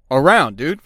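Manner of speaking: normal talking voice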